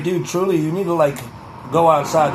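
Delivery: ordinary talk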